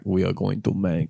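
weak hint of a Russian accent; lightly put-on Russian accent, slipping. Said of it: "that's not a good Russian accent".

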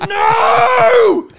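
high piercing hammed-up scream; mock screaming. Pranking a scam caller, high hammed-up screaming. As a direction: scream in fake horror down the phone. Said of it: "And I just started screaming".

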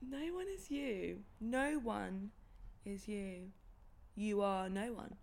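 slow and uncertain; slow, halting. Shown as a demonstration. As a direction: say it slowly, guessing. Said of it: "Do you know the end of it?".

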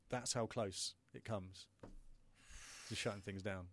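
normal talking voice

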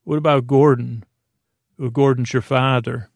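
ordinary talk

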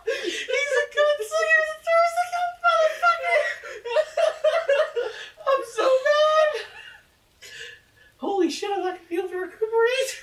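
high, loud, wheezing laugh-shout; crying with laughter while shouting; hysterical laughing. Real, not acted.

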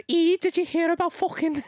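high-pitched Scouse gossip; Liverpool accent. Retold or imitated. Imitating a Liverpool friend with gossip, high Scouse accent. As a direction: imitate an excitable Scouse woman, pitched right up. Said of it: "Oh, she'll, she'll go".

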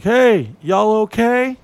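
slow, high, friendly stranger call-out; stranger asking from his car. Retold or imitated. Driver leaning out the window calling to the guys, slow and high. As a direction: drawl it up high like a passing driver checking in. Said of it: "is like".

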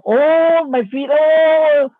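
high, drawn-out oh of relief; relieved oh. Retold or imitated. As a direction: let a long high oh of relief out. Feeling energy heal his feet.

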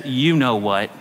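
low, insinuating parrot punchline; knowing parrot. Acted. Parrot to returning man, low and knowing. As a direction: drop the voice for a sly reminder.

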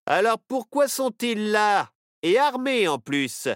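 a gruff lawman who doesn't trust them, taking his time; suspicious sheriff. Acted. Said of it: "répondit le shérif". (shérif méfiant, voix plus haute et lente)

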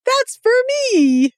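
high-pitched mock cooing; playful squeal imitating a delighted friend; imitated gushing reaction. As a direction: coo it high, drawing out the last word. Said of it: "you're just gonna be like".

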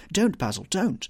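loud, high plea to stop; alarmed, pleading shout; desperate cry. A narrator's reading. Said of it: "he cried".

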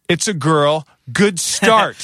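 high, loud sarcastic jab; snide remark to the nurse; snarky quip. Retold or imitated. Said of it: "Oh my God".

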